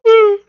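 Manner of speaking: high, loud whoop into laughter; real laughing whoop. Real, not acted. Game master tickled by the game, whooping with laughter. Cracks up at the table.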